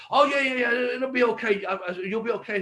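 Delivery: high-pitched imitation of a glib promoter; breezy reassurance. Retold promoter brushing off his worry.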